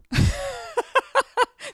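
amused laugh at the dog story; real laugh; high burst of laughter. Real, not acted.

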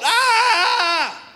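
high, loud drawn-out war cry; sustained high holler; battle yell. Acted. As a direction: let out a full-throated "aaah" yell. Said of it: "they just yelled".

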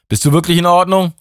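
high, loud worried call; Emma calling. A narrator's reading. Emma asks Mike if he is alright, high and loud. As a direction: reach him across the wind. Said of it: "rief sie".

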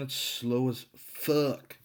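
drawn-out, hammed-up exasperation; exasperated. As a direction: drag it out slowly in mock frustration. Venting at slow internet connection.

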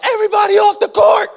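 high, loud laughing shout. Retold or imitated. Family yelling for everyone to clear the court, high and loud. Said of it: "the rest of my tribe is like".

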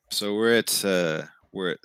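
ordinary talk

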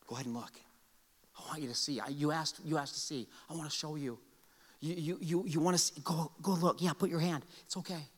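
softly voiced Jesus reassuring Thomas; tender Jesus. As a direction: coax Thomas kindly to look. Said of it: "Walks up to Thomas".

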